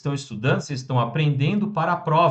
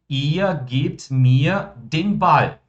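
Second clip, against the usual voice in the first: very slow, every German word laid out clearly; slow model sentence; 4 dB louder, much slower than usual. Shown as a demonstration. Teacher modelling German for students, very slow and clear. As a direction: spell the sentence out slowly for the class. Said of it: "nós temos a nossa frase afirmativa".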